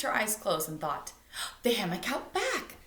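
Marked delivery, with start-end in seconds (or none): 1.6-2.8 higher, a touch breathless recall; sudden realisation. Acted. Girl suddenly remembering where she left the book. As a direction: gasp it out as it comes back to you. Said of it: "she gasped".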